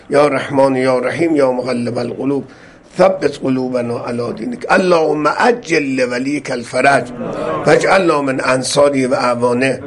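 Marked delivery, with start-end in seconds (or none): none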